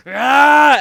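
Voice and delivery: loud, high imitated roar; rage-mode yell. Third grader flying into rage mode, imitated furious yell. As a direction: explode in a mock battle scream. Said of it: "I remember him yelling too".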